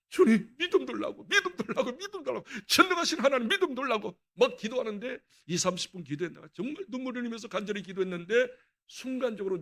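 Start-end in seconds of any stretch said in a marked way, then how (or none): 0.1-4.2 pleading prayer. Retold or imitated. Retold desperate prayer for faith.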